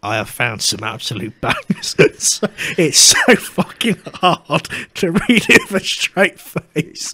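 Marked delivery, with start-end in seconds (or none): 2.0-7.1 laughing. Real, not acted. Laughing while describing reviews.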